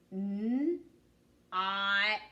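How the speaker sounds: "N" held, pause, then long "Ought"; sounded out. Shown as a demonstration. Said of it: "Tell me the sounds we hear in not".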